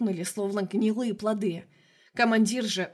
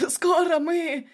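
feverish dying threat; 8 semitones higher, 4 dB louder, much slower than usual. A narrator's reading. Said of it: "Умирающий засмеялся и затрясся".

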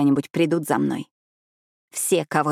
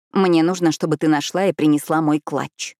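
plain reading voice

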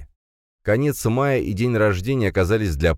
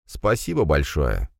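plain reading voice

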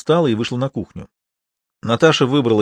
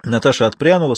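usual speaking voice

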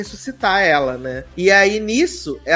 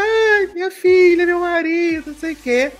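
mock breathless mother; 12 semitones higher than usual. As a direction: sigh it out as a dazed mother, overplayed. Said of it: "ela fica". (mãe atordoada, aguda e arrastada, exagerada)

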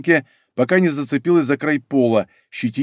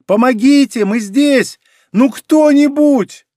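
high, loud desperate cry; loud, high-pitched plea for anyone; screaming for help; 10 semitones higher, 6 dB louder, slower than usual. A narrator's reading. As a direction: cry out for rescue, stretching the last words. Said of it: "закричала".

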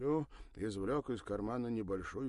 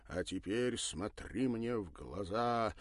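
slow, steady commanding voice; 2 semitones higher, 4 dB louder, slower than usual. Acted. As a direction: order him to lock eyes. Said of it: "приказал Бернар".

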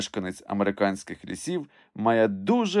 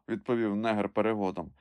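ordinary delivery, nothing marked

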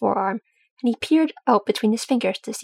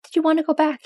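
careful, higher-pitched question; tentative, considerate asking; 6 semitones higher than usual. A narrator's reading. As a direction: ask it cautiously, testing the mood. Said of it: "he asked carefully".

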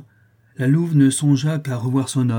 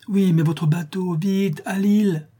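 insistent plea; 7 semitones higher, slower than usual. A narrator's reading. La Louve presses for boat.

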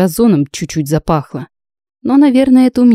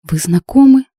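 a startled, higher ask; surprised; 7 semitones higher than usual. A narrator's reading. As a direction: jump up in surprise. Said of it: "удивилась я".

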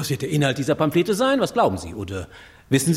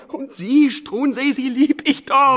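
desperate plea; 6 semitones higher, 3 dB louder, slower than usual. Acted. Frantic king after nightmare.

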